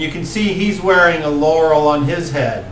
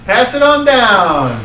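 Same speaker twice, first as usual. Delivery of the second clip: higher, louder stoner offering; stoner druid; 8 semitones higher, 4 dB louder than usual. Acted. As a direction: pass the joint around. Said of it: "Woody's like".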